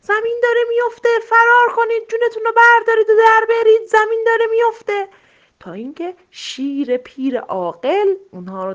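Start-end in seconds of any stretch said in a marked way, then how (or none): 0.0-5.1 very high, loud panicked alarm; shouting while fleeing. A narrator's reading. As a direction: cry out to everyone to run.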